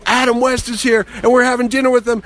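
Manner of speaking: high-pitched reenacted excitement; excited phone call. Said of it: "I called my wife up. I go".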